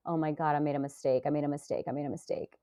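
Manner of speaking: low, hushed retold regret; regretful self-talk. Player recalling her regret at the vote, low and hushed.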